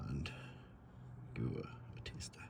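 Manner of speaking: soft unvoiced whisper. Real, not acted. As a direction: whisper it quickly aside. Aside while testing the water.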